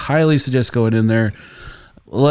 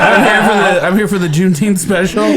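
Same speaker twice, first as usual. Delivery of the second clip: laughing mock claim; 5 semitones higher, 4 dB louder than usual. Imitating customer claim, laughing.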